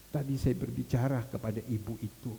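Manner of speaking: ordinary talk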